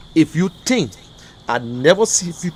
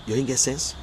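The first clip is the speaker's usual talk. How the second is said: softer, understated reproach; low-key scolding; 3 semitones lower, 8 dB quieter than usual. Real, not acted. Scolding those showing the boxes, low-key reproach. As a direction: toss the reproach out softly. Same words first said softly.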